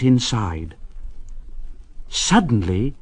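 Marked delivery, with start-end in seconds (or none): none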